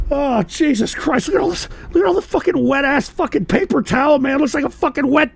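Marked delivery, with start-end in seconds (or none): none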